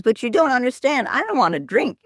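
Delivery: comic desperate monk voice; pleading monk. Retold or imitated. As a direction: blurt it out fast like a trapped monk. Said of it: "this monk says".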